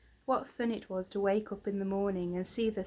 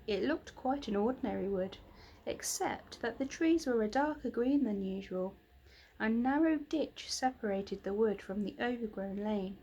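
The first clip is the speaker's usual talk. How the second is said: ordinary delivery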